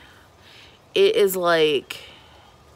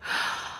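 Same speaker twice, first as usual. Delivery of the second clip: gasp; 9 dB quieter than usual. Real, not acted. Hears bad news from a viewer.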